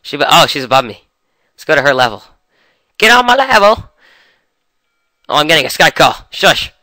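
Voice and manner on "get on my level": high, hyped meme yell; playful high battle cry; meme shout. Real, not acted. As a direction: belt the meme line up high. Chasing her upward, meme shout.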